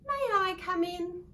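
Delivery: high, loud polite request; polite little frog. Acted. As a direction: ask sweetly like a small frog. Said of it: "Tree Frog asked politely".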